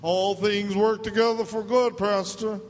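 high, loud mock question; naive question. Mocking a congregant misusing scripture, high and loud. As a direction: ask it like a naive churchgoer.

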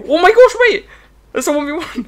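very high, loud outburst; real startled high-pitched shout; shocked yell. Real, not acted. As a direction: cry out in sudden shock. Sudden shock during play.

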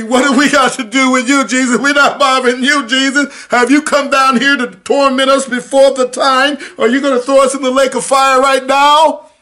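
high, loud, panicky pleading demon voice; frightened demons. Retold or imitated. Demons begging Jesus not to torment them, high frightened wail. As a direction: beg like terrified demons facing Jesus. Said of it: "They say".

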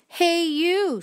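high, loud storybook call-out; grouchy challenge. A narrator's reading. Grouchy ladybug accosting a stranger, high loud challenge.

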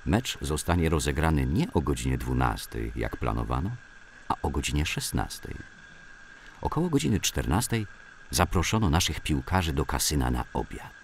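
usual speaking voice

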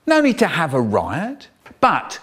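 ordinary delivery, nothing marked

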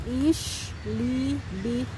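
"Ich liebe dich" drawn out very slowly and softly; very slow demo. Shown as a demonstration. Teaching the child German, slow and soft. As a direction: linger on every syllable of "Ich liebe dich". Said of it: "I love you".

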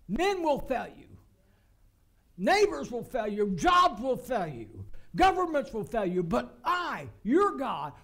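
loud, emphatic declarations, key words stretched; forceful preaching. Real, not acted. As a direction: drive each promise home from the pulpit. Preaches in God's voice at full force.

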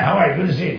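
a hint of a Greek doctor's accent; mock Greek accent, jovial. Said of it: "He talked like that".

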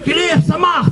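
high-pitched mock sergeant's order; strained shout without full volume; imitated yelling. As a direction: holler it like an impatient sergeant. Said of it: "למה הבן אדם צועק".

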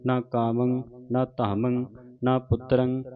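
slow, measured chanting of gurbani; recitation. Shown as a demonstration. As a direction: recite each line as a chant.

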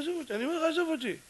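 high-pitched plea to be left alone; acted pestered man; exasperated brush-off. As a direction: whine it high, without extra volume. Crying man begs to be left.

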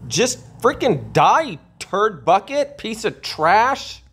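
high, slow raging yell at the game; angry outburst. Real, not acted. Gamer losing a fight yells insults at the enemy, high and slow. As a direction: spit the insults out high and drawn out.

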